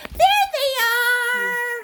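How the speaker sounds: high, loud, drawn-out find; slow, sing-out hide-and-seek reveal; triumphant discovery. Pretend. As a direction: announce the hiders with playful delight. Finds the hider.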